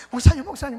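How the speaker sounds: loud, frantic calls of the pastor's title; a late-night phone call, shouted in alarm; urgent calling out. Retold or imitated. Evangelist calling pastor at midnight.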